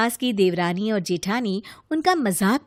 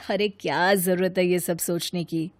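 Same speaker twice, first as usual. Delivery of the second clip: softer, calmer man's reply, a touch weary; husband voice; 5 semitones lower, 4 dB quieter than usual. Acted. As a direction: brush it off gently as the husband. Husband replies in another voice.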